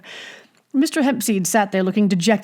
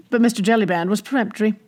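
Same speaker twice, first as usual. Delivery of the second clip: ordinary delivery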